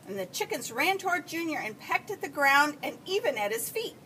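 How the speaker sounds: ordinary delivery, nothing marked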